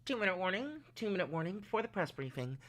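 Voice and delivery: imitating an intercom announcement; lightly voiced PA announcer. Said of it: "It was like".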